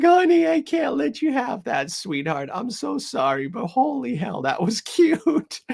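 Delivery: high, gushing sing-song for a pet; taking the roll away from a cute puppy, high cooing voice; baby talk to puppy. Retold or imitated. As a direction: coo at the puppy, barely holding back laughter. Owner talks to puppy, laughing.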